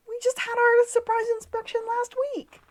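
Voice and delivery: a lightly voiced indignant owner; mock indignant [name]. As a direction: protest like a put-upon chef. [name] protesting the inspection.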